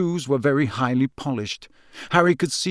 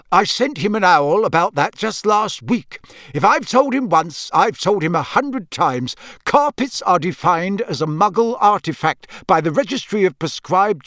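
higher, louder weary protest; exasperated official; 6 semitones higher, 4 dB louder than usual. A narrator's reading. Man tired of explaining carpet rules, exasperated. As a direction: complain as if explained endlessly. Narrator plays exasperated Mister Weasley.

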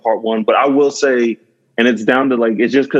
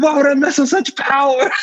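high, hammed-up anguish; mock a movie scientist lamenting, pitched way up; high-pitched playful impersonation; imitating Doc Brown. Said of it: "He's like".

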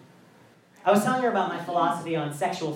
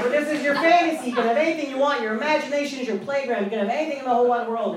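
higher, fast, coaxing pep talk to dudes; encouraging; 5 semitones higher, much faster than usual. Retold or imitated. Coaxing dudes to dream bigger, higher and fast. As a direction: sell the dream, upbeat and quick. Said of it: "You're like".

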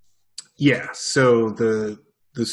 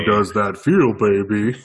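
louder, teasing lover's line; mock sultry voice; 6 dB louder than usual. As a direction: ham up a seductive croon. Mock seductive voice, joking.

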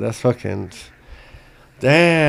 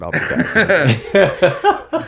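laughing drawl; 6 semitones higher, much slower than usual. Retold or imitated. Joking repeat of exit line.

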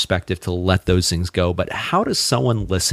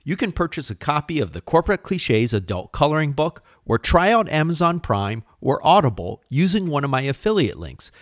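bright, quick podcast promo voice, pitched up; upbeat ad read; 6 semitones higher than usual. Acted. Host pitching listeners to support the podcast, upbeat ad read. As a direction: sell it like a cheerful host plug. Host reads sponsor plug.

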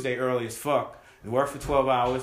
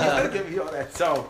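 laughing repeat. Retold or imitated.

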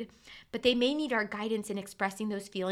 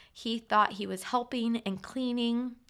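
normal talking voice

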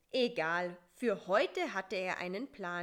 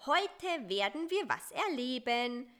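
bright, coaxing promise with a lift on wir; lightly sugary fly voice; cooing sweetly; 5 semitones higher, 5 dB louder than usual. A narrator's reading. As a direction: sweet-talk the spider into adventure. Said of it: "säuselte Busy".

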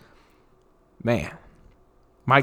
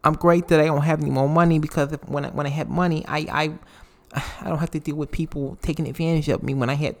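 higher, fast, soft lisping Tyson voice; Mike Tyson impression; 3 semitones higher, 3 dB quieter, much faster than usual. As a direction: rattle it off softly like Tyson explaining himself. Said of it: "And he's like".

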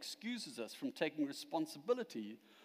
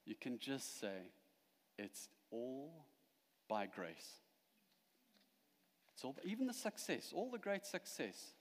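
soft, hushed pastoral comfort; gentle reassurance; 3 semitones lower, 6 dB quieter than usual. Real, not acted. Preacher comforting anyone anxious with grace, gently reassuring. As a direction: let it settle quietly, with long pauses. Preacher consoles the worried congregation.

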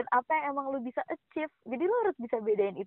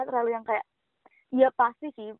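ordinary delivery

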